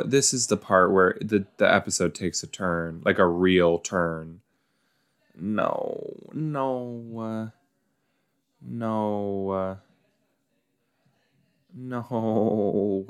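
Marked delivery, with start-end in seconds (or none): 5.4-13.1 soft repeated no; quiet pleading under the breath; bracing for the scene; dreading. Real, not acted.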